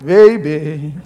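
a touch of low, soft reassurance; tender reassurance. Retold or imitated. Husband assuring his wife of his love, mildly tender and soft. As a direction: soothe her like a loving husband. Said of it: "And I would say".

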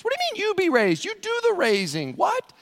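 squeaky, incredulous questioning; very high-pitched, confused protest; hammed-up bafflement.

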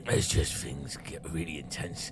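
soft, low Cockney rat; confiding Cockney rat. Acted. Rat confiding he can chill out with you, soft Cockney rat. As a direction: open up quietly as a Cockney rat. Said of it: "as long as it's a Cockney rat".

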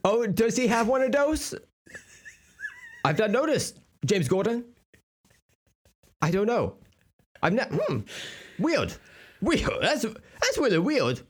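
high, evasive voice with muddled Rs; Bruce Wayne. Acted. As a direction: feign ignorance in a silly high speech-impaired voice. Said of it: "you have the same kind of a speech impediment".

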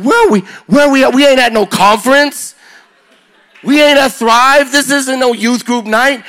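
high, loud, astonished where-are-we; amazed disbelief. Retold or imitated. Preacher retelling his shock at kids chanting Jesus, high and amazed. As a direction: marvel out loud at the scene.

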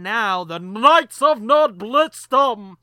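booming, slow announcer voice; grand announcer. Acted. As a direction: announce it like a title card. Announcing the segment name again.

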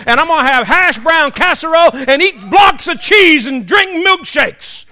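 loud, high, building food rhapsody; comic revival-preacher fervor; exuberant preaching. Real, not acted. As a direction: shout each dish like a glorious promise. Exuberant preaching about heavenly food.

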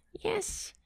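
put-on high, thin, soft old woman yes; old lady voice. Host imagining her voice in old age, old lady quaver. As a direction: creak it out like a little old lady. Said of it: "what I'm gonna sound like when I'm old".

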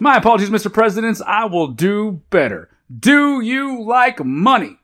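high, eager mock servility; hammed-up fawning pitchman; mock servile host. Host performing intro sketch.

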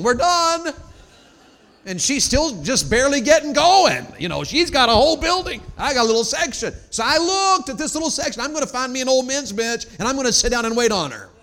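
high, shouted husband's complaint; worked-up preacher venting at full volume; exasperated comic rant. Real, not acted. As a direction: rant loudly from the pulpit.

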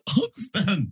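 high wheezing laugh, nearly in tears; crying laughing. Real, not acted. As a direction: laugh until it squeaks. Laughing hard after story.

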